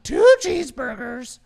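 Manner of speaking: very high, loud puppet voice; Pinocchio. Shown as a demonstration. Host showing everyday talk in the impression, high loud puppet. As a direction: order food in a piercing cartoon puppet voice. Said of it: "I don't talk normally, like".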